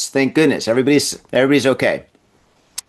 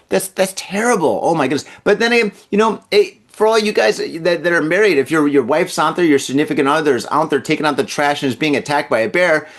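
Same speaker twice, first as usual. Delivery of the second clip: ordinary talk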